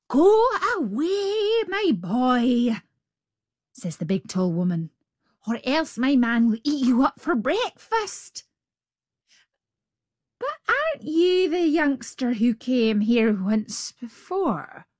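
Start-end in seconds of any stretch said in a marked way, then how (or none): 0.1-2.8 high, slow warning giantess. Acted. Giant's wife warns Jack. 5.5-14.9 lightly voiced big woman; a hint of the giantess. Giant's wife speaks.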